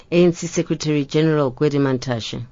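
normal talking voice

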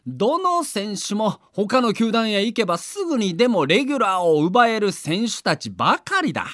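ordinary delivery

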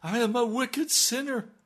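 high, loud desperate cry for help; screaming plea. Acted. Desperate mother screaming to Jesus for help. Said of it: "She is screaming".

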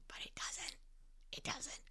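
soft unvoiced whisper of reassurance; hushed, gentle whisper. Shown as a demonstration. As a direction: breathe it out like a secret.

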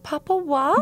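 high, sweet and naive, lightly voiced; innocent girlish voice. Young queen cooing a pet name to the king, innocent and girlish. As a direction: coo it like an innocent girl. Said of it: "and she's like".

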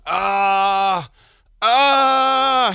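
high rising frustrated ahs. Retold or imitated. Rivellino groaning at the failed fax, high frustrated ahs. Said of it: "he's going".

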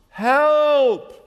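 loud, high, drawn-out holler; hollered cry for help. Acted. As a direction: act out a man sinking, yelling for rescue. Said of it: "he hollers at the top of his lungs".

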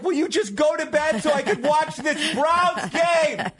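high pleading exasperation; begging the kid to sleep; exasperated dad. Retold or imitated. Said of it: "I'm just like".

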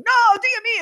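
high, indignant yelp of protest; outraged Italian husband. Retold or imitated. Husband outraged at wife's prank, high yelp. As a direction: squeal it like a wounded Italian. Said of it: "And he's like".

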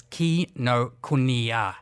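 "Kinokuniya" slowly, as kee-no-koo-nee-ya; correct pronunciation. Shown as a demonstration. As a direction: sound out "Kinokuniya" syllable by syllable. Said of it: "What is the correct pronunciation".

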